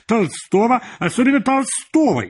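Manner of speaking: very high lecturing character voice; high-voiced editor. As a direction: lecture on the classics in a high-pitched voice.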